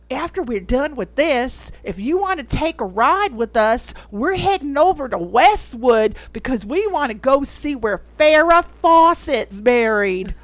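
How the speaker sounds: imitating a lady from the South; bad southern accent. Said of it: "bad southern accent".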